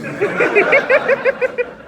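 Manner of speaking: high burst of laughter; loud laugh. Real, not acted. Narrator laughing after the emperor's jab. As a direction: break up laughing.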